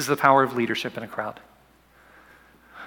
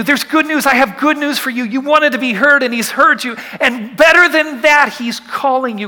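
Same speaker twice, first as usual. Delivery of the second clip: higher and louder, crowd eagerly urging him on; excited calling out; 9 semitones higher, 8 dB louder than usual. Retold or imitated. Crowd shouting good news to blind Bartimaeus, excited. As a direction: call out joyful good news to the blind man.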